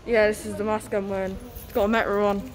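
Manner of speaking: ordinary delivery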